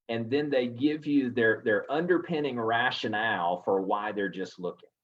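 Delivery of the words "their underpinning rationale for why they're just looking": usual speaking voice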